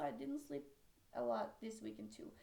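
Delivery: ordinary talk, nothing marked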